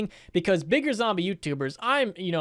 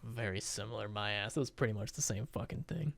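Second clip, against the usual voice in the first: low, quiet dismissive grumble; scoffing aside; 8 semitones lower, 13 dB quieter than usual. Real, not acted. YouTuber scoffing at the played apology, in a low muttered aside. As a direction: grumble it under your breath, unconvinced.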